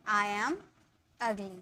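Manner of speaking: ordinary delivery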